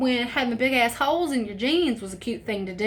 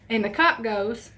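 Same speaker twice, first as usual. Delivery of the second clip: ordinary delivery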